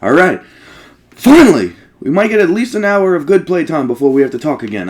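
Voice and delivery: finally free to play; excited cheer then rushed chatter; relieved excitement. Real, not acted. Relief after long cutscene.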